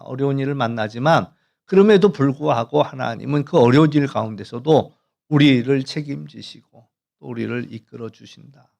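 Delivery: normal talking voice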